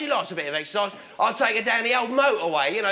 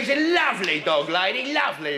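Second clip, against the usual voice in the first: ordinary talk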